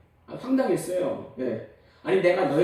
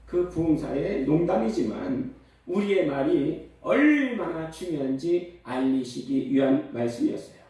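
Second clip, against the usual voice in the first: ordinary talk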